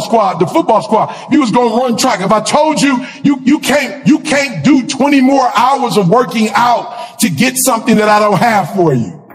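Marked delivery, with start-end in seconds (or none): none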